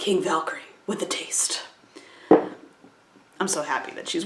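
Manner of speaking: hushed, breathless fan whisper. Real, not acted. Reviewer gushing over Valkyrie's t-shirt, whispering. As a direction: whisper it like a delighted secret.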